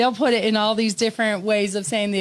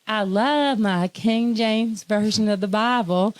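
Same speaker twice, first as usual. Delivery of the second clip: slow, drawn-out Southern drawl; Southern accent; slower than usual. Retold or imitated. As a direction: do your best Southern belle imitation, unhurried. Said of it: "she said it in a Southern accent".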